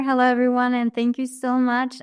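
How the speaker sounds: normal talking voice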